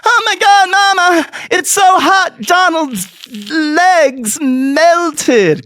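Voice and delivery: put-on high, loud child voice; a kid wailing to mama about the heat; high squealing kid; little kid voice. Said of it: "And the kid goes".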